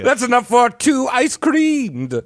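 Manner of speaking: gleeful tiny win; childish delight over money; goofy kid voice. Pretend. Jokey excited childish line.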